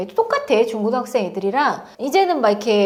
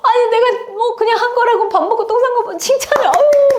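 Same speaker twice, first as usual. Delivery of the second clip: high, gushing mock parent praise; mock doting parent cooing over a toddler; 11 semitones higher, 4 dB louder than usual. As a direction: overdo the delight like a doting parent. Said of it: "막 이래".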